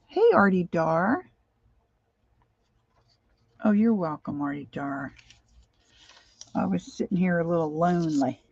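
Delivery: ordinary talk, nothing marked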